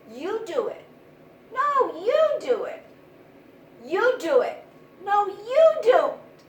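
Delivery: high, loud back-and-forth quarrel; mice bickering. Acted. Mice shouting at each other to bell the cat, loud. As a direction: push the job off with rising heat.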